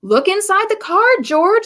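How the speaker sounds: high, bright urging; eager animals. A narrator's reading. Animals eagerly tell George to read the card. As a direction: push him gently to look. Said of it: "said the animals".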